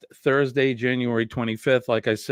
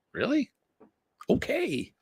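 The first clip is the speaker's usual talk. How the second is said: surprised; 13 semitones higher, 5 dB quieter than usual. Retold or imitated. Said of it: "I'm like".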